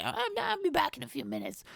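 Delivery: put-on high girly voice. Comedian mimicking the girl's excuse, high girly voice.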